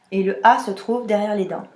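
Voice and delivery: ordinary delivery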